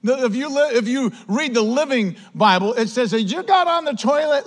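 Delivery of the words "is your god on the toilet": high, teasing taunt; mocking jibe. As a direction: needle them with a pitched-up question. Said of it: "starts to mock him and make fun of him".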